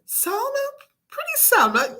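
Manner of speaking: very high-pitched granny coo; doting Granny. A narrator's reading. Granny addresses supposed granddaughter.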